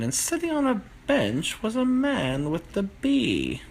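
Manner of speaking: plain reading voice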